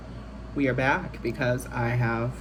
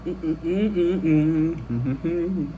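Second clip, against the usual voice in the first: savoring chewing hums; much slower than usual. Real, not acted. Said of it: "another chicken finger".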